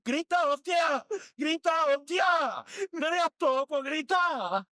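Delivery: retold shout of "Grita, hostia!"; urging shout. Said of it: "A pegar grito".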